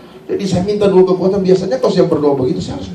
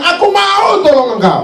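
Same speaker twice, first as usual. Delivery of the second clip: high, stretched-out cry of promise; triumphant proclamation; 4 dB louder, slower than usual. Acted. God promising help to the believer, triumphant proclamation. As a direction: call it out high, drawing out the words.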